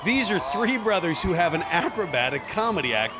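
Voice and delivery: normal talking voice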